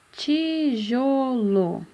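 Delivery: tijolo very slow, each syllable held. Shown as a demonstration. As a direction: stretch out ti-jo-lo. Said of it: "Começa com qual sílaba?".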